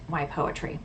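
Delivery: normal talking voice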